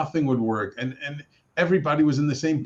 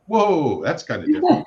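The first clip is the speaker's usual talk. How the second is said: laughing surprise; 8 semitones higher, 5 dB louder than usual. Retold or imitated. Retold surprise at monk news.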